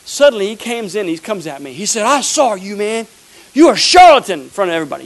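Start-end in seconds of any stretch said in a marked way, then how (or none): 2.0-4.4 loud accusing shout at the preacher; angry heckler. Retold or imitated. Retelling the heckler yelling at the preacher in front of everybody, loud accusation.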